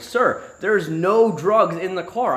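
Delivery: slow, drawn-out protest; slow insistence. Retold or imitated. Slowly telling the trooper there's no drugs in the car. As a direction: spell it out slowly to the officer.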